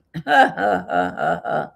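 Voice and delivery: real chuckling laugh after the joke; amused laugh. Real, not acted. Having retold mom's morbid joke, laughing. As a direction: chuckle at your own story.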